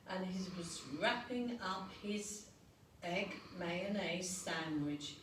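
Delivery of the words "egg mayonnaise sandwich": plain reading voice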